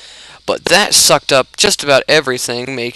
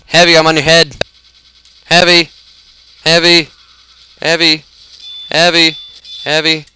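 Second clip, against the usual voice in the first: higher, repeated call to get attention; insistent calling; 4 semitones higher, 4 dB louder than usual. Pretend. Player standing on Heavy's head, insistent calling. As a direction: pester him with the same raised call. Pestering a teammate in game.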